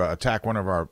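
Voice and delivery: ordinary talk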